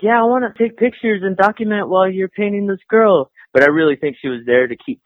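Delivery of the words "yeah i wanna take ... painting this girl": high-pitched girlfriend impression; imitated girlfriend. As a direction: mimic her in a raised pitch.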